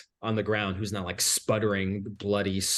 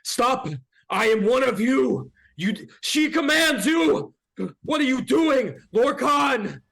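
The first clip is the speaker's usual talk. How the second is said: high, loud desperate shouting; 7 dB louder than usual. Acted. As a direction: yell at the devil to stop. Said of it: "go up to the devil and say".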